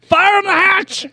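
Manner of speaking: put-on high, loud, slow old-timer cry; old prospector. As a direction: holler like a frontier geezer. Old cowboy bit continues.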